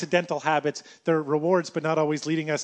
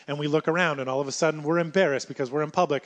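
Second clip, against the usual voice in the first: ordinary talk, nothing marked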